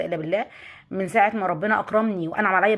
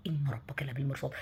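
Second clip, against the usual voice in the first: much softer, a near-whisper to herself; hushed, as if in her ear; 6 semitones lower, 12 dB quieter than usual. Retold or imitated. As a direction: murmur it like a voice inside your ear. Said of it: "لقيتني سامعاها في ودني". (همس خافت كأنه في الودن)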